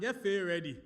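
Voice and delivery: loud crowd call in Ghanaian pidgin style; rally the room Ghana-style; Ghanaian-flavoured hype. Real, not acted. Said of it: "since we're in Ghana land".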